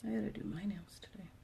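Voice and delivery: hushed whisper to yourself. Real, not acted. Muttering while working.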